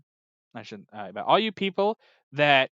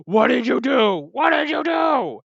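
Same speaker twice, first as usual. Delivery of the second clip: higher, louder, slowing, building to a yell; mock shout; 8 semitones higher, 5 dB louder than usual. Kingpin confronts Maya after the vision, rising angry shout. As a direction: yell it at her, accusing. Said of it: "and Kingpin goes".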